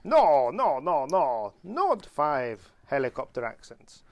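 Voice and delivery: imitated Italian-accented correction; Italian-accented denial, emphatic at first, then slowing. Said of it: "in an Italian accent, obviously".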